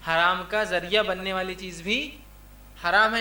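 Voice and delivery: measured teaching pace, held rise on "بھی" then falling close. Real, not acted. Repeats the rule for emphasis.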